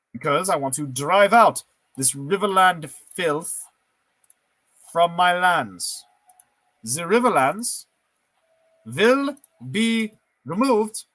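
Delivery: a lightly put-on German villain declaring war; mock German warlord speech, drawn out; German accent. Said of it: "Why am I doing a German accent?".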